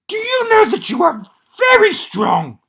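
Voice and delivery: a panicked puppet monster begging the reader; Grover voice. Acted. Grover voice reading.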